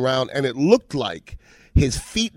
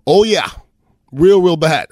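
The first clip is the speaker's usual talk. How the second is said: retold emphatic dread; 4 semitones higher, 6 dB louder than usual. Dreading teammate answers at film meeting.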